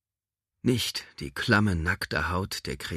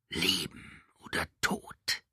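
hissing creature; 4 dB quieter, slower than usual. Acted. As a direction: hiss the question like a beast. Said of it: "zischte die Kreatur".

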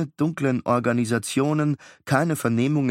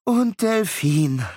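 slow, reluctant giving in; resigned sigh; 9 semitones higher, 4 dB louder, much slower than usual. Acted. Miles giving in to his brother, slow resigned. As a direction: concede it with a weary sigh. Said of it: "Miles seufzte".

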